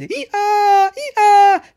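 very high, loud braying; donkey bray. A narrator's reading. Pinocchio braying in pain to his master, loud bray. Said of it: "cominciò a piangere e a ragliare".

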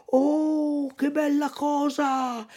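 high delighted puppet cry; dance with glee as you say it; cry of joy. Acted. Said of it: "gridò Pinocchio ballando dall'allegrezza".

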